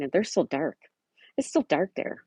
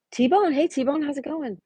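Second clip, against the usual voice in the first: excited greeting; 8 semitones higher than usual. Real, not acted. Greeting a viewer in chat.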